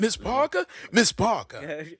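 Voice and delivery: calling out like the movie; quoting Bernie Mac; Bernie Mac impression. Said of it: "That was Bernie Mac".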